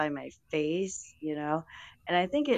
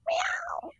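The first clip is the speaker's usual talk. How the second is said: whispered meow; 3 dB quieter than usual. Pretend. Meowing game on stream.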